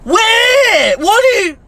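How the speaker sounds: high-pitched, incredulous wail; outraged outburst. Acted. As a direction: burst out in exaggerated disbelief. Outraged at parents' threat.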